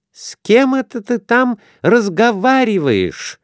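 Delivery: high, drowsy woman's voice from the next room; sleepy wife's voice. A narrator's reading. Wife just woken, calling to her husband sleepily. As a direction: call out half-asleep from the other room. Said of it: "заспанный голос".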